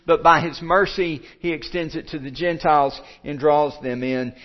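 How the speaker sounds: a preacher lifting his voice to press the point; rising preaching. Acted. Preaching builds to full force.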